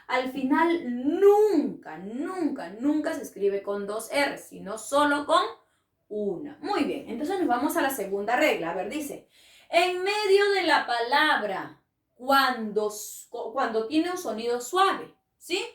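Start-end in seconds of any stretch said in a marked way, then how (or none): none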